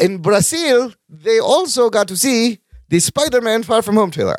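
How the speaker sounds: mock Brazilian accent, not very convincing; playful, badly done Brazilian accent. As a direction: put on a muddy Brazilian accent. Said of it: "I'm doing a Brazilian accent".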